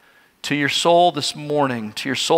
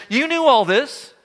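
higher-pitched, urgent reproach; prophet's rebuke; 6 semitones higher than usual. Acted. Daniel confronting Belshazzar over what he knew, urgent higher-pitched rebuke. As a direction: confront the king in a raised pitch. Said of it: "He says, Daniel says".

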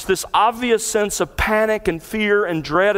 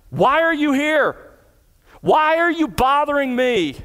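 high, aggressive demon challenge; malevolent aggression; 11 semitones higher than usual. Retold or imitated. Demon challenging Jesus in the synagogue, aggressive. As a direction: snarl it out with menace. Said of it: "full of malevolent aggression".

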